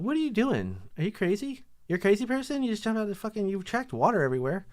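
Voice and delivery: high-pitched mock scolding; girlfriend coming out. Girlfriend finding water everywhere, high scolding voice. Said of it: "here's how I think she comes out".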